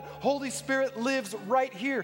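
high-pitched, insistent sermon climax; forceful preaching. Real, not acted. Preacher telling believers the Spirit lives within, insistent preaching. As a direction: drive each point home to the congregation.

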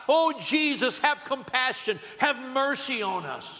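high, pleading preacher's call; crying out for mercy. Retold or imitated. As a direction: call out as a blind beggar in a crowd. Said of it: "to the top of their voices they were crying out".

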